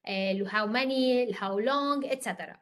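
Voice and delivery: normal talking voice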